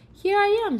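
high, loud call down from a tree. Acted. Stillwater calls down from the tree to Michael. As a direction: call to a friend below. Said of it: "Stillwater called from the tree".

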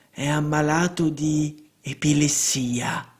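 slow hushed whisper of a clairvoyant; whispered diagnosis. Acted. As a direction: breathe it out like a secret. Seer whispers her diagnosis.

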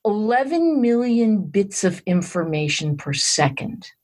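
slow, measured delivery of a big figure; deliberately slow. Real, not acted. Telling the interviewer a shocking brain figure, deliberately slowly. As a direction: spell it out slowly so the figure lands. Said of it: "I'm gonna say this slowly".

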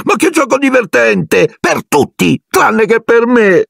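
a ghost puffing up and shrieking her bitter joke. Acted. Ghost girl shrieking sarcastically at Harry, very high and slow. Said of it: "strillò".

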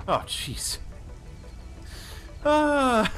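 ordinary delivery

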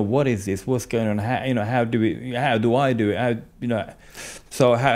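normal talking voice

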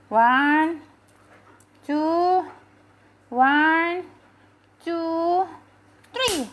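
loud, long-held count with rising pitch; drawn-out counting. Real, not acted. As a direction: count off loudly, stretching each number. Counting out loud for pet command. (hitungan lantang berlarut)

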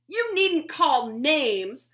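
loud, slow indignant shout; shouting back. Acted. Betsy shouting back at Tacy, loud and offended. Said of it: "Betsy shouted back".

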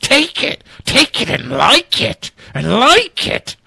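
high, strained mock bully voice; ungov version. Host mocking the WTO chief as a bully, high shrieking order. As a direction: shriek it like a bully forcing a deal. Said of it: "that's the ungov version of that".

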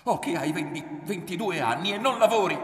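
loud, high, indignant shout; angry accusation. A narrator's reading. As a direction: hurl the accusation at him. Mother's accusing shout in quarrel.

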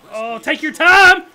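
slow, loud sarcasm at the fielder; sarcastic. Real, not acted. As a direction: drag it out mockingly.

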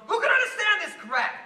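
sudden high, loud yell; exasperated outburst. Acted. Citizen baffled by world troubles, exasperated shout. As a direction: blow up in frustration.

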